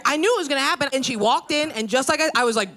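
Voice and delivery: usual speaking voice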